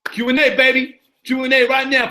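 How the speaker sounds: usual speaking voice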